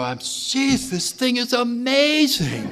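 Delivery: high-pitched amazed exclaiming; excited, amazed. Retold or imitated. Recalling his own thrill at the message, high and amazed. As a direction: gush with wonder at the message. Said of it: "I go".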